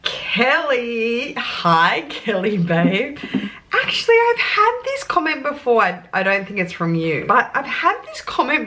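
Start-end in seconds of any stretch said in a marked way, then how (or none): none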